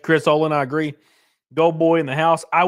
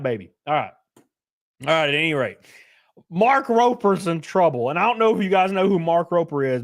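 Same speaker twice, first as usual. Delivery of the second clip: usual speaking voice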